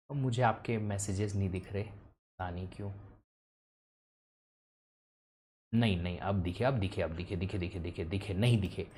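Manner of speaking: low, soft talk half to oneself; quiet aside. Real, not acted. Teacher checking whether students' messages show up, quiet aside. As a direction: drop the voice low while checking.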